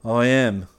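self-satisfied, firmly voiced; a touch of smugness; complacent. A narrator's reading. Elliot answering that he is like Hastings, complacently. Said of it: "observed Elliot complacently".

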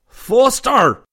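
huge, high bellow of rage; bellowed name. A narrator's reading. Enraged commander bellows at Rip over the speaker, furious roar. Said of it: "He bellowed".

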